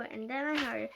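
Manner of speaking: plain reading voice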